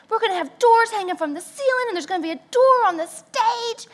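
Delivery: high fast enthusiastic; mock excited husband. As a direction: rattle off the plan. Husband describing plan.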